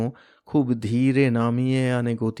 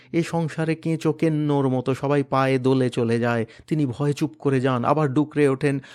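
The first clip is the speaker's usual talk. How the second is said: usual speaking voice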